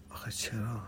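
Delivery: whispered reproachful question. A narrator's reading. Girl silently asking the narrator why, whispered. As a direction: breathe it out, hurt and unvoiced.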